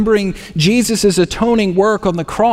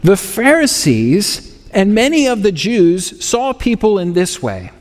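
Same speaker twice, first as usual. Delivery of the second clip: ordinary talk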